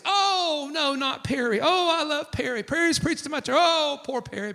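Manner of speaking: high-pitched exaggerated pity; mock dismay. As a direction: imitate gushing, distressed sympathizers. Mimics dismayed gossiping colleagues.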